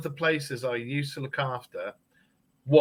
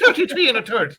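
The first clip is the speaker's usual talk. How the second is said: "thirty-three and a third" with a higher, louder Irish lilt through a laugh; laughing mock Irish accent; 11 semitones higher, 7 dB louder than usual. Shown as a demonstration. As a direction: give the Irish accent again, cracking up. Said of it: "in the Irish accent".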